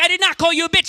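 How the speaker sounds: high, loud insistent denial; mock outraged denial. Boyfriend shouting back at his girlfriend, loud denial. Acted quarrel, denying.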